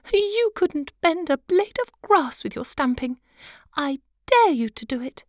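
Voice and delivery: high, light wife's voice; high pitched fussy little wife; butterfly wife. Acted. Wife dares husband.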